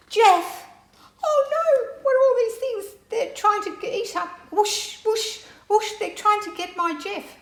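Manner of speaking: high-pitched, loud cries of alarm; frantic storybook squeal, very high; acted panic. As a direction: cry out as if plants are grabbing your dog. Nora panics as plants attack Jeff.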